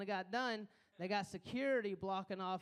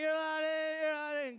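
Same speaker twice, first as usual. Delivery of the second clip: playing a bouncer, turning people away; bossy pretend guard, louder and higher; mock bouncer; 5 semitones higher, 5 dB louder than usual. Pretending to be security.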